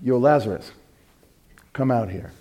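low, quiet, casual summons; understated mock-casual beckoning; casual offhand call. Shown as a demonstration. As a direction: toss it off softly, barely calling. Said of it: "He didn't stand at the tomb and go".

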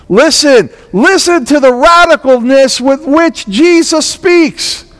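preacher building up over the resurrection; fervent preaching. Acted. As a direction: press the point home to the congregation. Preaching at full force.

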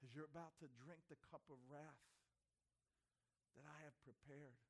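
soft, heavy voice of the Father; sorrowful Father. Retold or imitated. Father foretelling Gethsemane, soft and sorrowful. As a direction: break it to him gently. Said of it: "He says".

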